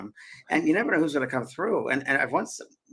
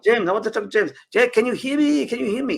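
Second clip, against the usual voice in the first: high, loud, insistent calling of James; spirit Frenchman; 9 semitones higher, 5 dB louder than usual. Retold or imitated. Retelling the seance, a spirit demands James, high and loud. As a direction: call for James like an eager spirit. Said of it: "It's a Frenchman".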